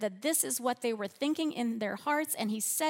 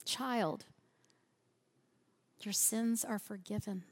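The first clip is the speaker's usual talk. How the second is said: gentle; 6 dB quieter than usual. Shown as a demonstration. Preacher softly speaking Jesus' words to the congregation, tender. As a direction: hush it gently like comforting a child. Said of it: "who might need to hear that".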